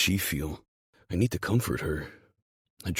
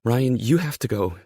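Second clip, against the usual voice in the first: high, loud, urgent warning; anxious raised voice; urgent plea; 7 semitones higher, 8 dB louder than usual. Acted. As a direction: press him to leave, afraid. Frightened character urges him to leave.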